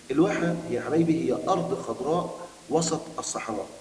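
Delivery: normal talking voice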